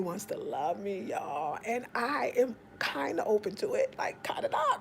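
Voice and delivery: whispered confession; whispering. Real, not acted. Admitting to listeners she's open to love, whispered. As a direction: whisper it like a secret. Confiding about Kwame.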